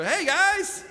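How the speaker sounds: high, chirpy mock-cheerful hello; forced happy greeting. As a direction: overdo a breezy, happy entrance. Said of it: "I'm trying to be happy".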